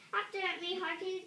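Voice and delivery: usual speaking voice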